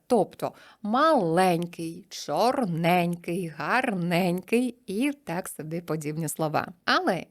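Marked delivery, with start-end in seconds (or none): 0.1-3.5 маленький and чорненький drawn out, stress on ень; very slow stress demo. Shown as a demonstration. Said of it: "буде наголошуватися". (дуже повільно, наголос на «ень»)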